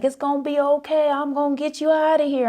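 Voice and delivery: high-pitched Southern rescuer's voice; reassuring Southern rescuer impression; serious Southern accent. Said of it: "a serious Southern accent".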